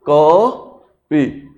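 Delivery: "को" drawn out long, then a separate "पी"; correct long vowel. Shown as a demonstration. Teacher modelling for students, long "को" then "पी". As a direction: hold "को", pause, then drop onto "पी". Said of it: "इसको बोलो".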